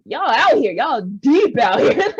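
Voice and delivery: loud, slowed amazement with a laugh; amazed laughing. Retold or imitated. Amazed at big communities.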